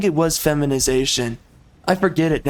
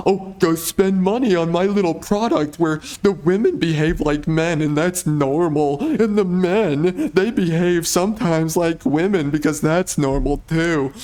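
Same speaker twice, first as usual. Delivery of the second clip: mock sales pitch. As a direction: parody a studio hawking its product, only faintly put on. Mocking the studios' sales pitch.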